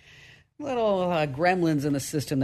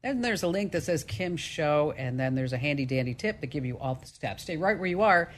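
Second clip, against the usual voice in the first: usual speaking voice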